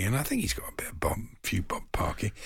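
a quick conspiratorial whisper; whispered aside. Real, not acted. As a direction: whisper the aside under your breath. Whispered aside about Parky's money.

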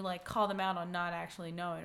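usual speaking voice

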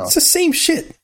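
put-on very high squeaky childish jab; squeaky bratty voice. Mock bickering, squeaky retort.

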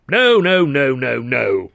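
loud, falling string of denials; indignant refusal. Acted. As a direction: dismiss the explanation outright. Indignant brother rejects the explanation.

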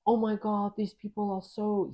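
imitating an Australian accent, pitched high; philanthropy host impression. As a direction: put on the host's high Australian-ish voice. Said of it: "his voice is like".

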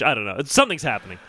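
usual speaking voice

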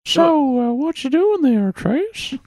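very high cartoon old-creep impression; high-voiced creepy cartoon character; Mister Herbert. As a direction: pitch it way up, as the Family Guy character. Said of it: "Mister Herbert".

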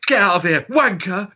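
mock London geezer, loud and high; loud mock London accent; London dub voice. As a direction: shout it like a cheesy London dub of a Thai film. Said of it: "All the guys are, like, um, from London".

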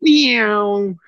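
high mock meow through laughter; imitated meow. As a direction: toss off a silly cat meow. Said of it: "what are you doing".

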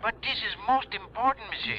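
put-on French accent. Louis pleading.

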